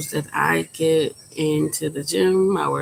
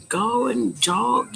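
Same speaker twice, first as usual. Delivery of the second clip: higher, slow mock advice; mocking imitation; 6 semitones higher, much slower than usual. People making fun of fat people, mock dismissive.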